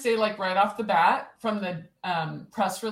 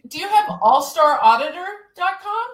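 excited; 7 semitones higher, 7 dB louder, faster than usual. Real, not acted. Sudden excited question about domain.

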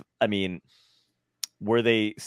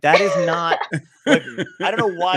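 laughing protest; 7 semitones higher, 7 dB louder than usual. Retold or imitated. Friends reacting to bad accent.

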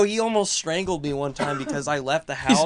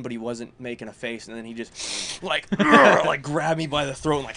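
ordinary talk

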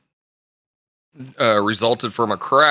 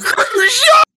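high, loud, breaking with laughter; laughing recruit shout; 6 dB louder than usual. Retold or imitated. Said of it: "I said".

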